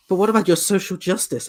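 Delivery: high, put-on voice of an online critic; a mocking imitation of a preachy commenter; mock whiny critic. Mocking an online critic who demands social justice. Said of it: "And they're like".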